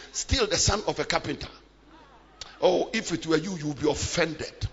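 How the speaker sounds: ordinary talk, nothing marked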